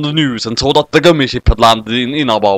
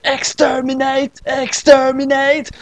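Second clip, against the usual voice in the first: high, slow, drawn-out robot chant; Dalek; 12 semitones higher, slower than usual. A narrator's reading. Daleks calling from the spaceship, high robotic cry.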